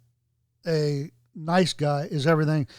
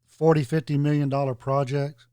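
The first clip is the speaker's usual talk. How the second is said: ordinary talk, nothing marked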